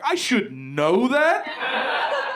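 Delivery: slow, high, rising disbelief; incredulous echo. Retold or imitated. Comedian throws his friend's words back, incredulous. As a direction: draw it out into an outraged question. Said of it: "I'm like".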